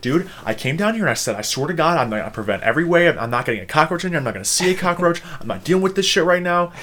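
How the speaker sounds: worked-up, hurried retelling of a fit; retold freak-out. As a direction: rattle off your refusal in mock panic. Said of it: "I was freaking out".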